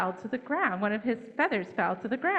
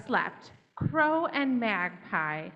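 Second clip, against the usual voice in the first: ordinary delivery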